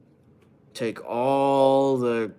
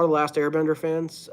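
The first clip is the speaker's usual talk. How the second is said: ordinary delivery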